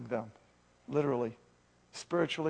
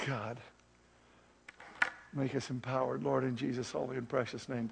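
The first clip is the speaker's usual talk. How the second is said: closing prayer. Acted. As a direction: pray it gently, drawing them in. Pastor closes sermon in prayer.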